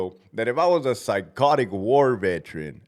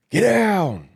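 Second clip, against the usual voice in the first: high, loud barked order; forceful re-enacted command; commanding shout; 10 semitones higher, 7 dB louder than usual. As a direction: yell it across the gym.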